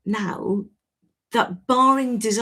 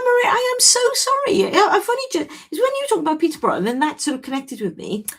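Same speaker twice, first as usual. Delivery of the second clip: much higher, gushing apology to a friend; excited apology; 9 semitones higher than usual. Real, not acted. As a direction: say sorry as the penny drops, delighted. Apologises to a recognised old friend.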